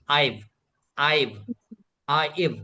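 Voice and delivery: "आइव" said as aa plus short i plus v, quick; chanting "आइव" for the learners to copy; drilled pronunciation. Shown as a demonstration. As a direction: repeat "आइव" clearly for the class. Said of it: "Hindi में लिख सकते हैं आ छोटा ई और व".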